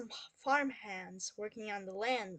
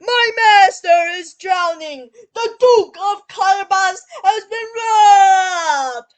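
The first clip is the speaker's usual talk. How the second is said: high, drawn-out shouted alarm; yelling for help; 9 semitones higher, 21 dB louder, slower than usual. Acted. Cat raising the alarm to the passing king, long yelled plea. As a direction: cry out slowly at full volume, stretching the last word. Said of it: "yelled Puss as the king passed by".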